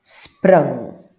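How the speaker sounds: "Sprung" called out loud; model word. Shown as a demonstration. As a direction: announce "Sprung" clearly. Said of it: "slightly different pronunciation".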